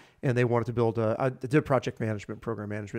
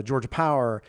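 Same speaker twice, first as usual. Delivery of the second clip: normal talking voice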